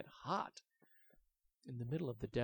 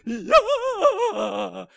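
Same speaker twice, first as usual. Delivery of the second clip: very high, loud squeal; gleeful dragon yell; 19 dB louder than usual. Acted. The dragon answering Willy, a high gleeful squeal. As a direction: shriek it with glee.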